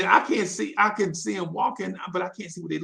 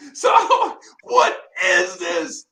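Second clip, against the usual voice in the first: laughing; 8 semitones higher, 4 dB louder, much slower than usual. Real, not acted. Cracks up at his own story.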